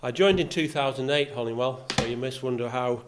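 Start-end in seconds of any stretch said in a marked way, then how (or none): none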